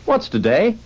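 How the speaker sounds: high, loud questioning shout; calling down. A narrator's reading. Scrooge calling down to a boy, eager high call. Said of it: "calling downwards to a boy".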